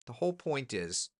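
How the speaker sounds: ordinary talk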